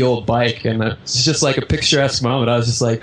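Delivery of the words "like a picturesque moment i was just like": usual speaking voice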